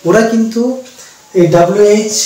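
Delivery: ordinary talk, nothing marked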